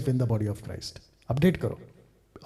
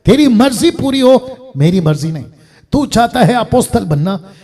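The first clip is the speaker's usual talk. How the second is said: raised appeals with dropped answers; preached prayer; 9 semitones higher, 8 dB louder than usual. Acted. Preacher showing congregation how to yield to God, emphatic preaching. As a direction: call out each line as if to God.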